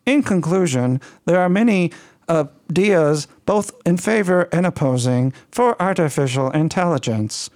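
slightly flat, stiff speech-synthesizer voice in a mild French tint; Stephen Hawking voice. Pretend. Host keeps the Hawking-in-French voice for the outro. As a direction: play a computer voice reading the outro, lightly. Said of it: "like Stephen Hawking speaking French".

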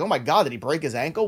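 ordinary talk, nothing marked

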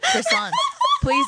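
excited squeal. Real, not acted. Excited squeal about carbs.

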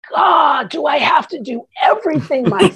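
loud, high mock-exasperated outburst; hammed-up fed-up cry. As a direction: imitate a worn-out mother who has had enough. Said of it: "just so exasperated that she's like".

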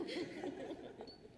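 soft airy laugh; a quick breathy chuckle; light laugh. Real, not acted. Laughs after inviting grandma.

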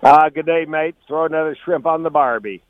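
put-on joking Australian accent, laid-back; lazy Aussie twang, tossed off casually. Joking Aussie greeting after Sydney trip.

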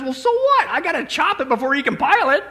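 high-pitched, dismissive comeback; mock exasperation. Joey rolls his eyes at the general, mock exasperated. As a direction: imitate an intern rolling his eyes. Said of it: "just rolls his eyes and says".

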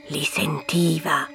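hushed, breathy narration; whisper. A narrator's reading. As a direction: drop to a whisper.

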